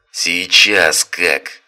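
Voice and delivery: slow, loud menacing threat; threatening shout. Acted. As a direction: bellow the threat slowly. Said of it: "угрожающий расправой голос".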